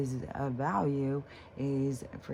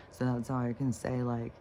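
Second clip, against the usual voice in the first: ordinary delivery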